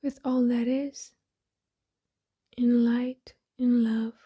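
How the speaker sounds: soft, breathy meditation voice with long pauses; hushed, unhurried affirmations spoken as if eyes closed; guided meditation. Acted. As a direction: settle inward, softly, phrase by phrase.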